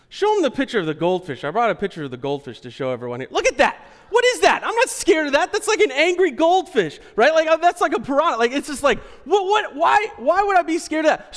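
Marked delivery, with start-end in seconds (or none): 3.3-11.3 loud, high scoffing; high mocking disbelief. Real, not acted. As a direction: scoff at a harmless fish. Mocking the goldfish picture.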